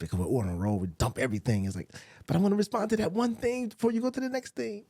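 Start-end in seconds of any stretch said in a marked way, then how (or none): 2.3-4.8 high-pitched mock whine; acted impatient protest. Acts out impatient listener in meeting.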